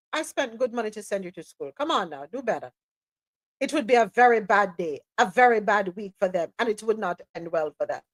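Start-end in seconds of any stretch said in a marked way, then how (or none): 0.1-2.7 quiet, let-down parental scolding; hushed mock reproach to a grown child; mock disappointed parent. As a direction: softly chide like a disappointed parent. Parent scolding Rubio, played.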